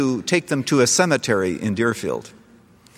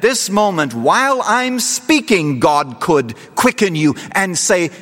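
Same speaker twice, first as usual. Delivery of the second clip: altar call; 8 semitones higher, 4 dB louder than usual. Acted. Preacher urging listeners to believe, at full force.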